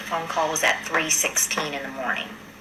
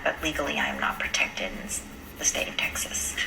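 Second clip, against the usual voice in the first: hushed confiding whisper; 3 semitones lower, 8 dB quieter than usual. Real, not acted. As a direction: drop to a whisper, sharing a risk. Confiding legal risk.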